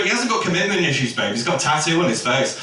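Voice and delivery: quick, dismissive rebuttal; combative best friend. Acted. Best friend going to war over the ex, briskly dismissive. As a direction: shoot it down fast for your girl. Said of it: "She went to war for her girl".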